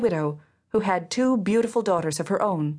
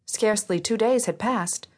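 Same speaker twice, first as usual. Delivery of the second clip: ordinary delivery, nothing marked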